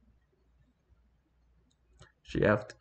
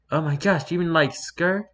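much higher, hammed-up girlish voice; high heroine voice; 7 semitones higher than usual. A narrator's reading. Heroine asking Graystripe about another cat, high heroine voice. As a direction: squeak it as a gossipy question.

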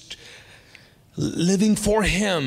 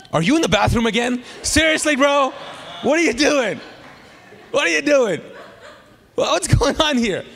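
high, loud exasperated mock yelling; hammed-up shout at a noisy caller; 9 semitones higher, 4 dB louder than usual. Pastor retells yelling at staff on a Zoom call, hammed-up shout.

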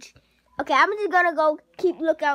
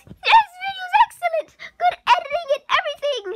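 much higher and louder, fast gushing; mock excited viewer; 5 dB louder than usual. Vlogger voicing a thrilled viewer comment, high and gushing. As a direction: gush like a thrilled fan.

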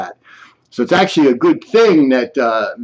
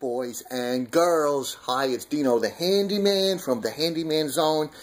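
announcer intro; 10 dB quieter than usual. Acted. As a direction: present it like a TV handyman. Separate recorded host segment.

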